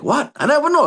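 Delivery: a sudden high, loud burst of disbelief; retold surprise. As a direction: blurt it out like you can't believe it. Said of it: "I was like".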